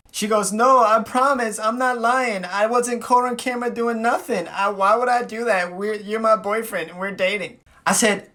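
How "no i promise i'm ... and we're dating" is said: high, whiny imitated protest; mock pleading. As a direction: imitate a girlfriend begging to be believed. Imitates girlfriend pleading innocence.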